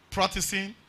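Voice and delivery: normal talking voice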